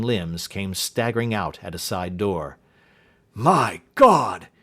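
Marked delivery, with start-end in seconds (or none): 3.3-4.5 cried out. Acted. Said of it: "he cried".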